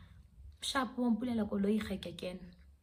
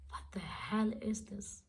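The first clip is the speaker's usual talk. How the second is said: slow, whispered disbelief; stunned whisper; 8 dB quieter, slower than usual. Retold or imitated. Girlfriend reacting to boyfriend's breakup speech, stunned whisper. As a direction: whisper it slowly under the breath.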